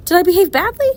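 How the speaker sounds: put-on girl suddenly fearing she misbehaved; alarmed girl. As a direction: blurt it out in worry.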